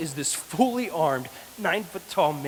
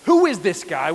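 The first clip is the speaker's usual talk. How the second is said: raised voice, demanding to know; loud challenge; 8 dB louder than usual. Retold or imitated. Young David loudly questioning Goliath before the soldiers. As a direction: call it out loud and bold. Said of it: "he's being loud about it".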